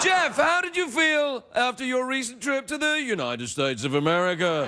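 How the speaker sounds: posh British announcer voice; British broadcaster. Pretend. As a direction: speak like a British TV presenter. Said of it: "Let's talk like British T V announcers".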